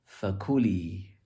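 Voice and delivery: "fakuli" with the ku stressed, slow; "fakuli", peak on ku then drop. Shown as a demonstration. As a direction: lean slowly on the middle syllable of "fakuli". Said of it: "more eloquent".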